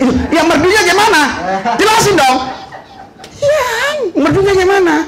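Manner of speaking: high, quick, laughing push for an answer; teasing, laughing challenge. Retold or imitated. Pastor teases the educated man, laughing high. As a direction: needle him with a laugh.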